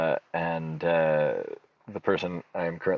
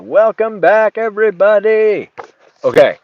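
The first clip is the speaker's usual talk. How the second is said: big welcome; 13 dB louder than usual. Real, not acted. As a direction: belt out the welcome back. Host greets audience returning.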